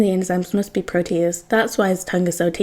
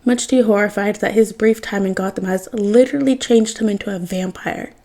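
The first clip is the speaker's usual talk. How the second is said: plain reading voice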